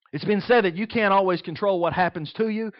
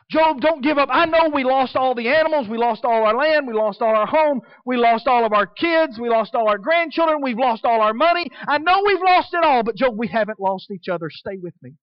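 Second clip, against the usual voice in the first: louder, higher, urgent plea; pleading encouragement; 5 semitones higher, 5 dB louder than usual. Retold or imitated. Wife passionately urging Job not to give up, pleading. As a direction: beg him to hold on with rising fervor. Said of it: "supposed to tell him".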